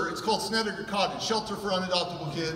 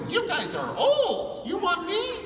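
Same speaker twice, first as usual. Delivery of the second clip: put-on much higher, slow, laughing child voice; blunt kid surprise; 11 semitones higher, slower than usual. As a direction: ask it with a kid's cheeky disbelief. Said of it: "I go".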